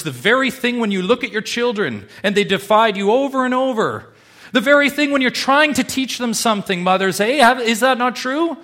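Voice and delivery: put-on preacher appealing to mothers about defiant children, loud; impassioned preaching. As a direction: press it on the mothers.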